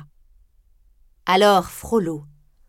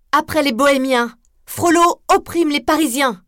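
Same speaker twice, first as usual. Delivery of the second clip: high, loud indignant cry; exclaiming; 5 semitones higher, 4 dB louder than usual. Acted. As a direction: call out the injustice to the crowd. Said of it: "s'exclama Esmeralda".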